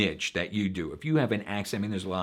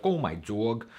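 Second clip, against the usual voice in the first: put-on New York accent; slower than usual. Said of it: "she was like".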